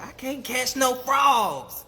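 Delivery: high, loud wail of frustration; hammed-up bellyaching; exasperated complaint. A narrator's reading. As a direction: whine it out big, drawing out the last words. Brer Coon complaining in the story.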